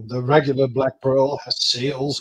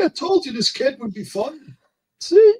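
gloating; 9 semitones higher than usual. Real, not acted. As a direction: crow it triumphantly. Gloating at friend.

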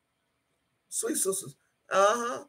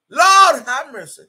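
louder, calling out to the Lord; loud cry to the Lord; 4 semitones higher, 10 dB louder than usual. Real, not acted. Grieving daughter calls out to the Lord, loud. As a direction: let it burst out of you in grief. Overcome while talking of mother.

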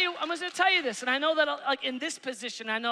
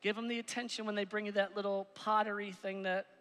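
usual speaking voice

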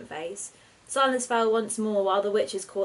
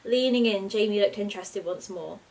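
ordinary delivery

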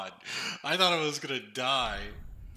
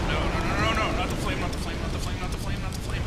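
panicked pleading; 5 semitones higher, 6 dB louder, faster than usual. Real, not acted. Dodging boss flame attack.

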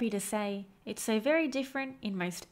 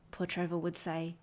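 plain reading voice

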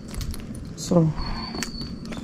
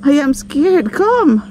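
1 high coaxing call; 8 semitones higher than usual. Real, not acted. Calling the monkeys, laughing.

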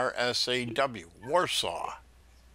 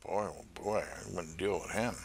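much softer, lower grumbling aside; muttered aside; 7 semitones lower, 9 dB quieter than usual. Real, not acted. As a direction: mutter it under your breath. Muttered aside about yelling colleague.

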